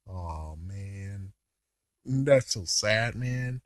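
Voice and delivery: very soft, low murmur of pity; mock sorrow. Recapper reacting to the hurt puppy, mock sorrow. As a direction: drop low and quiet, as if moved.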